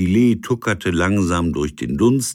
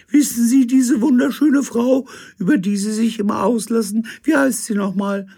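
very high, loud falsetto; Gisela's high voice; 6 dB louder, faster than usual. Acted. Figure at the door greets the captain, very high. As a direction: chirp it in a shrill lady voice. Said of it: "mit hoher Stimme".

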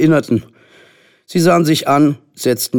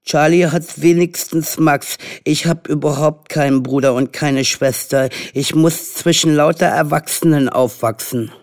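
put-on higher-pitched, plaintive little boy; self-pitying complaint; 5 semitones higher than usual. A narrator's reading. Henry lamenting to mother about having no siblings, plaintive. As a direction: plead your case like a hard-done-by child. Only child pleading pitifully to mother.

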